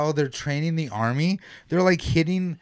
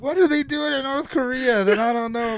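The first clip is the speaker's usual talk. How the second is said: amazed disbelief; 10 semitones higher than usual. Retold or imitated. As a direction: act baffled by the news. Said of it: "I was like".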